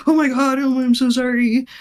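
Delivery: imitating a panicky, apologetic student; imitated distress. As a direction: gush out a distressed apology, retold. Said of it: "legitimately, like, distressed".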